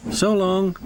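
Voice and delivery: high, smiling farewell; brave smile. A narrator's reading. Oliver seeing Duck off, smiling bravely. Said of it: "smiled Oliver bravely".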